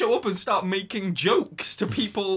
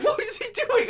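incredulous. Real, not acted. Incredulous at the character.

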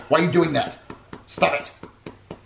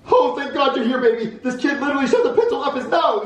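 high-pitched, frantic outpouring; frantic relief; 10 semitones higher than usual. Acted. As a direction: blurt it out in a high, panicky voice. Retake, frantic babysitter greets partner.

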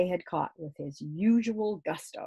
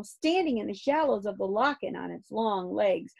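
usual speaking voice

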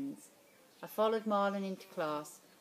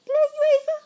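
very high, loud mocking squeak; bully mocking the friend's jibe; 18 dB louder, faster than usual. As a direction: throw it back at him, shrill with scorn.

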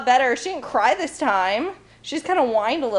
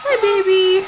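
high sweet voice to the dog; 7 semitones higher, 4 dB louder than usual. Real, not acted. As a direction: coo at the puppy. Baby talk to the puppy.

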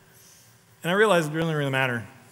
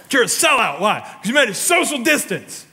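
mock angry critic, higher and louder; lightly hammed-up accuser; accusing critic; 7 semitones higher, 4 dB louder than usual. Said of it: "I'm like".

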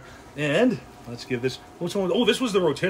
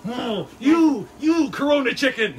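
hammed-up fake rage, much higher and louder; mock angry; 9 semitones higher, 7 dB louder than usual. Asked by his wife to cut like he's angry, mock furious yell. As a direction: playfully snarl at the chicken while hacking it. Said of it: "Like you're angry".

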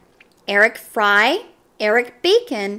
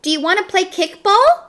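shout; 8 semitones higher, 5 dB louder than usual. A narrator's reading. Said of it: "Damien shouted".

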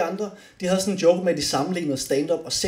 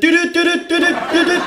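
high, louder dyt dyt like a car horn; mock horn honks, much higher; honking imitation; 9 dB louder than usual. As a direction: toot it out like a horn. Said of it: "bruge hornet".

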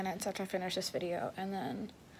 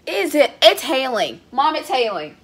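loud, high calls to mom; calling out; 5 semitones higher, 16 dB louder than usual. Real, not acted. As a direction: shout the news to mom across the house. Calling mom about the hail.

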